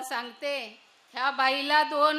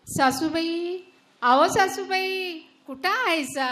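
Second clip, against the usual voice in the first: loud call across the house; 4 semitones higher, 7 dB louder than usual. Acted. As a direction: call out for her, drawing out आहो. Calling mother-in-law across house. (लांबून हाक मारणे)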